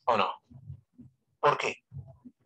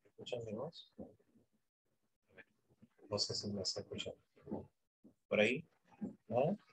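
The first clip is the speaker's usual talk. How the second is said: much softer, unamplified voice; voice without amplifier; 4 semitones lower, 14 dB quieter than usual. Shown as a demonstration. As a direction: speak softly, off the microphone. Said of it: "¿Quieres escuchar el volumen de mi voz?". (voz baja sin amplificador)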